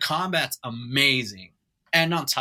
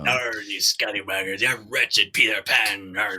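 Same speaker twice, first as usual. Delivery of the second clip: pirate voice; 4 semitones lower than usual. Acted. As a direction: play a cartoon pirate. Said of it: "Wow, that's pretty good".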